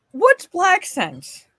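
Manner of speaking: very high, slow put-on silly voice; mock dumb voice. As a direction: play dumb in a squeaky drawn-out voice. Mocking put-on repeat.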